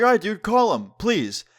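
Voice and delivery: pushy retold challenge, starting high; retold defiant dare. Retold defiant dare during quarrel.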